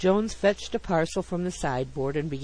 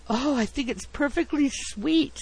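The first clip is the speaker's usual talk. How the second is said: high-pitched society-lady cooing; effusive admiration; gushing; 9 semitones higher than usual. A narrator's reading. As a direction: swoon over a darling doll. Wife gushes over a doll.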